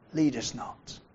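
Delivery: gentle and easy. Shown as a demonstration. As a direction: ask gently, without demanding. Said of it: "far more gentle and easy".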